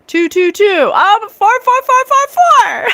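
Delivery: hammed-up repetition escalating into shouting; building mock shout. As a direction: climb to a very high, loud yell. Escalating mock pitch from a tempter.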